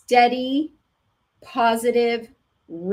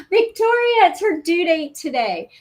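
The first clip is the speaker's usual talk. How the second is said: high, loud delighted gasp to Victoria; excited; 8 semitones higher, 6 dB louder than usual. Real, not acted. Teacher noticing a student's due date, excited. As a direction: burst out with the happy news.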